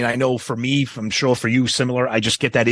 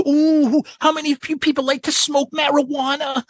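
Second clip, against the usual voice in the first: much higher squealing mock frontman; mocking the frontman's stage banter, pitched way up; 13 semitones higher than usual. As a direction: parody the singer's over-eager crowd talk.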